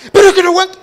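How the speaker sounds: más agudo y mucho más fuerte, desesperado; suéltalo como quien ya no aguanta; grito impaciente. Pretend. Impaciente estalla. (grito desesperado de impaciencia)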